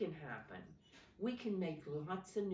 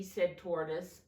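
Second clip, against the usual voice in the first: ordinary delivery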